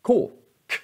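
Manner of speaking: K, K said as a hard, plain k; a crisp hard K sound. Shown as a demonstration. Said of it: "då är K-et bara K". (hårt K-ljud)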